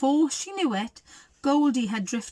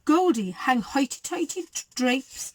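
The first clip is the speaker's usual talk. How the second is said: plain reading voice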